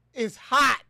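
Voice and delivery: loud, high cry of it's hot; hammed-up heat complaint. Streamer joking to viewers about the heat, loud mock wail. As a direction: wail it like the heat is killing you.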